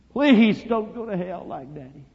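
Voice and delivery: high, slow, drawn-out begging; imploring child's wail to Mama; desperate plea. Retold or imitated. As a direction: cry out PLEASE loudly, then ease off. Boy begs his mama harder.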